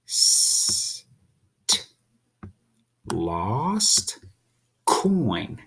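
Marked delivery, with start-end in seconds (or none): 0.0-1.9 a single unvoiced "St"; sounding out st. Shown as a demonstration. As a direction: breathe out "St" crisply. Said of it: "two little sounds inside there".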